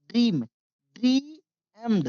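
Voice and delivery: ordinary talk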